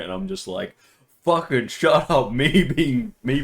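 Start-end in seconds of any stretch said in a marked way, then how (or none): 1.2-3.4 louder, higher, slow-punched retort; mock angry snap. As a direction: act out losing patience with a friend. Acted quarrel with co-player.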